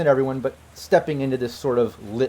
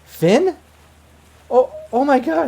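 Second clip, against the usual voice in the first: high, stunned disbelief; acted shock in a raised female voice; shocked ex-girlfriend; 13 semitones higher, 6 dB louder, slower than usual. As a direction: gasp it out high, recognising a lost love. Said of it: "she just freaks out".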